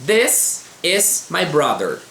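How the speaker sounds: "This is my brother" read very slowly, each word separated; slow model reading. Shown as a demonstration. Teacher reading example sentences to students, very slow. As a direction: spell out "This is my brother" word by word for learners. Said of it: "Vamos ler exemplos aqui".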